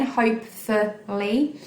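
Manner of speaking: "Hopefully" in three separate beats; syllable-by-syllable "Hopefully"; split into syllables. Shown as a demonstration. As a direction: say "Hopefully" as hope, ful, ly, ending on a rise. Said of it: "Three syllables again".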